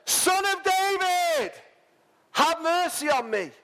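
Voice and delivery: very high, slow, drawn-out plea; desperate cry. Acted. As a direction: stretch the call like a desperate man. Blind man shouting louder still.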